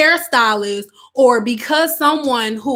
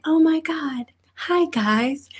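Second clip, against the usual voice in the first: mock influencer greeting, lifted pitch; put-on YouTuber voice; 3 semitones higher than usual. Hairstylist mimicking the voice expected on social media, put-on YouTuber voice. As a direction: parody a polished online-video host. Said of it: "I don't talk like that".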